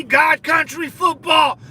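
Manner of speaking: slow, loud, high shout; real excited yell, stretched out. Real, not acted. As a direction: belt it out like a rally cry. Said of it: "I get excited".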